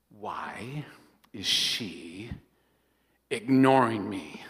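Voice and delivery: brooding inner voice, pausing between words; suspicious inner voice. Retold or imitated. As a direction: murmur it like a wounded thought.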